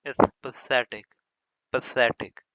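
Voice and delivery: a lightly voiced fussy English teacher saying pathetic, drawn out slowly; mocking English teacher. Teacher mocks how English teachers say pathetic. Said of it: "English के teacher को".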